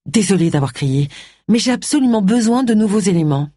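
ordinary delivery

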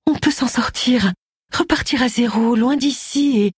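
voix douce et aiguë de l'épouse. A narrator's reading. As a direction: rassure doucement ton mari, voix haut perchée. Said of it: "d'une voix douce". (douceur persuasive, dans l'aigu)

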